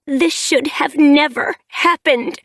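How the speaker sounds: high, loud, bitter anger; furious daughter blaming the clan; bristling. A narrator's reading. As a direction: spit it out, fur on end. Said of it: "Sparkpelt was bristling".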